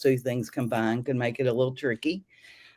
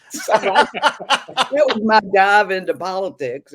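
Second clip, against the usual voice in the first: slow, laughing through the words, higher and louder; amused, laughing sign-off; 7 semitones higher, 8 dB louder, slower than usual. Real, not acted. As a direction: chuckle out the wrap-up line. Laughing after "pissed off ever since.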